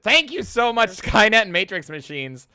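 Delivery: loud, high, gushing gratitude; excited thanks. Real, not acted. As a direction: shout the thanks with glee.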